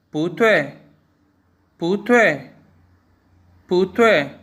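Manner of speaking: पुत्वे as bú duì, rising bu then falling dui; Mandarin bú duì. Shown as a demonstration. Teacher models the tone change three times for learners. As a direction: drop sharply on the dui of पुत्वे. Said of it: "मसलन".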